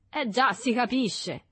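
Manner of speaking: slow, high sing-song teasing; drawn-out mocking agreement. A narrator's reading. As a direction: tease with a knowing lilt. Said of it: "in tono di canzonatura".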